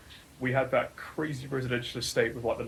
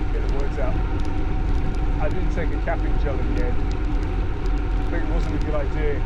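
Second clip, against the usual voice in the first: talking loudly while riding; 3 semitones higher, 9 dB louder than usual. Real, not acted.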